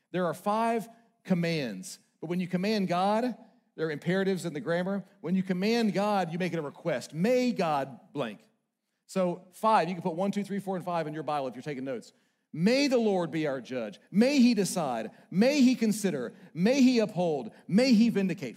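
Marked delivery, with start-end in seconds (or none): 0.1-10.6 forceful preacher voice; preaching at full force. Acted. 12.5-18.6 ringing preacher recitation; loud psalm reading. Acted. Reading psalm petitions aloud.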